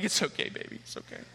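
calm her down in a whisper; hushed comfort. Retold or imitated. Dad holding crying toddler, whispered comfort.

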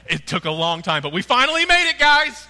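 very high, loud cheering; celebrating disciples. Retold or imitated. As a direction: whoop like you've won.

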